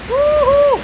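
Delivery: high whoop; joyful whoop. Real, not acted. Celebrating the rain.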